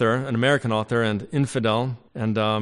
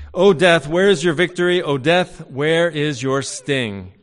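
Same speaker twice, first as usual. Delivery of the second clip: booming scripture reading, easing down; ringing proclamation; 4 semitones higher, 6 dB louder than usual. Acted. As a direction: proclaim it like a triumphant verse from the pulpit. Preacher declaims Paul's verse.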